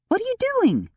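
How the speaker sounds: very high, sharp alarmed question; alarmed cry. A narrator's reading. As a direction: cry out in alarm at his move. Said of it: "Theodosia cried".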